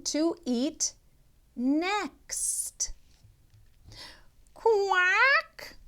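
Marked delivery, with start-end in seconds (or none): 4.5-5.8 high, put-on duck quack.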